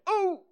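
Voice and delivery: very high little "ooh"; high jolted "ooh". A narrator's reading. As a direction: yelp on the bounce up. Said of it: "As he went up and down in the air".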